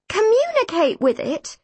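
high-pitched, baffled question; incredulous echo. A narrator's reading. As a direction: echo it in disbelief.

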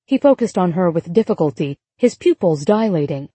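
plain reading voice

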